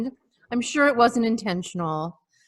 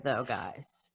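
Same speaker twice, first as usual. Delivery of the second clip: whisper; 11 semitones lower, 12 dB quieter than usual. Real, not acted. Whispered aside.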